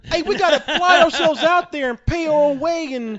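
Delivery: high, loud, giddy put-on voice; mocking eager sucker. Mocking eager convention hopefuls to his cohost, high and giddy. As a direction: squeal it like an overexcited rube. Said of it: "you think I'm just gonna jump?".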